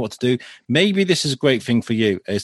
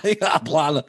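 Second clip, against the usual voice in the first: echoing a Scottish impression with a laugh; rough copy of a Scottish accent; mock Scottish accent; 4 semitones higher than usual. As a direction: mimic the Scottish accent back.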